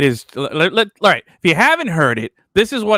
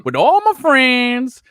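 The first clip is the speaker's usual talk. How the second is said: mock Southern accent; 6 semitones higher than usual. Host imitating coach as a Louisiana local, mock Southern accent. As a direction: ham up the down-home Louisiana guy. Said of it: "mocking coach's new Southern persona".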